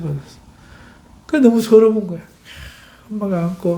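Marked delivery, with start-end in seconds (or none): none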